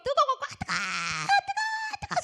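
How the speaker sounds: high, loud cries from the burning; imitated cries of pain. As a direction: cry out as if scalded, imitating sufferers. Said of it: "소리를 막 지르면서".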